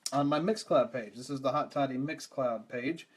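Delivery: normal talking voice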